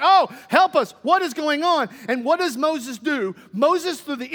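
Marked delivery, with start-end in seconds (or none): 0.0-1.9 raised panicked plea, higher and louder; crying out for help. Acted. Israelites crying out to Moses, loud and panicked. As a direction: cry out like a frightened crowd. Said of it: "they're crying out saying".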